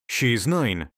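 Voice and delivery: plain reading voice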